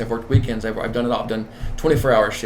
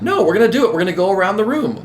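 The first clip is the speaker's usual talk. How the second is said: higher and louder, a cheerful firm override; insisting; 6 semitones higher, 3 dB louder, faster than usual. Retold or imitated. Manager overruling staff who roll their eyes, firm and upbeat. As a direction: brush off the eye-rolling and push on.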